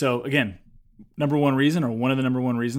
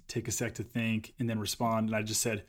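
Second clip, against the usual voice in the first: normal talking voice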